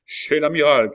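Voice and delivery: high, very loud call to arms; shouted rallying cry. A narrator's reading. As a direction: shout the destination to the band. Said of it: "cria Maurevel". (cri de ralliement puissant)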